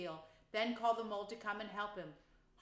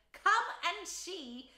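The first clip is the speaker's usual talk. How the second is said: higher, louder, slow gleeful call; delighted; 10 semitones higher, 6 dB louder, much slower than usual. A narrator's reading. Rat calls mole over, delighted and jigging. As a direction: beckon with delight. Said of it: "said the delighted rat".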